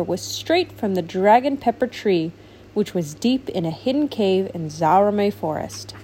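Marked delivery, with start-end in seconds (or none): none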